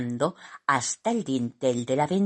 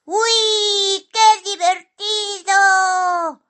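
high, drawn-out squeal of delight; loud, slow, squeaky thrill; enthusiastic shout; 6 dB louder, much slower than usual. A narrator's reading. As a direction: whoop like riding the wind. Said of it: "gritó entusiasmado".

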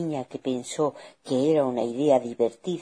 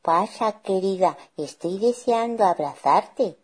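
greedy, put-on higher granny voice; wicked wolf as granny; 5 semitones higher than usual. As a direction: lure the child in hungrily. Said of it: "dijo el lobo malvado relamiéndose".

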